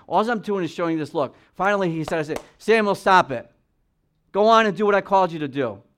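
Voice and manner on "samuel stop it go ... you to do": raised, louder voice of God ordering Samuel on; firm rebuke, push him to move; stern command. Acted. God urging grieving Samuel to move on, loud stern command.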